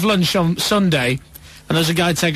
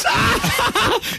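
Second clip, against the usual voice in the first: a much higher, delighted laugh; high giggle. Retold or imitated. Mum laughing at the photographer, high and delighted. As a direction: cackle like a tickled mum.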